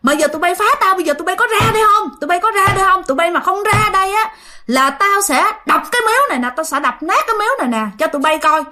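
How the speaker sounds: high-pitched, indignant tirade; angry cursing. Acted. Father threatens to smash the spirits' shrine, angry cursing. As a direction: challenge them angrily, pitch held high. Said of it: "Bắt đầu ba chửi".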